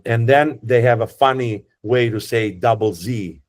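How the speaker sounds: ordinary talk, nothing marked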